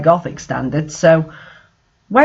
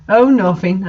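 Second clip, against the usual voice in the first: usual speaking voice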